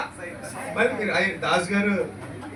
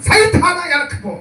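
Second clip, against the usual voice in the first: higher and louder stern rebuke; rebuking command; 7 semitones higher, 6 dB louder than usual. Retold or imitated. Jesus rebuking Satan before Peter, a raised stern command. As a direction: drive Satan back with a sharp order. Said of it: "దేవుడు అన్నాడు".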